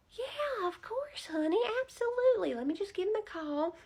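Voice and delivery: very high, sugary grandma greeting; high doting old-lady voice; sweet old lady voice. Retold or imitated. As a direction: coo at him like a doting granny teller. Teller voiced in retold story.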